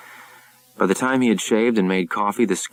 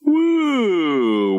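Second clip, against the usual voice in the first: big waking yawn; 12 semitones higher than usual. Acted. Sleepy deckhand woken by captain, high yawning whoop. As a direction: stretch awake with a long rising yawn. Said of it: "Sharp yawned".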